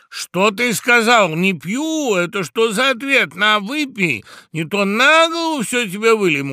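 high, loud, outraged toastmaster; bully him into drinking, loudly; threatening. A narrator's reading. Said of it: "угрожающе проговорил Тамада".

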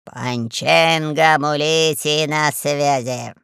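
low, slow, lazy drawl; Панченго Мулити. A narrator's reading. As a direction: answer lazily, stretching every word. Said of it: "лениво шевеля толстыми".

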